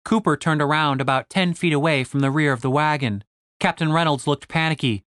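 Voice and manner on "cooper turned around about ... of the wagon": usual speaking voice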